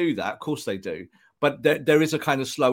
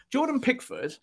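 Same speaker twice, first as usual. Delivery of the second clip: "Jordan Pickford" launched much higher; rant opener; 13 semitones higher than usual. Real, not acted. Pundit opening a rant to his co-host, high-pitched launch. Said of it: "let's do a little Jordan Pickford rant".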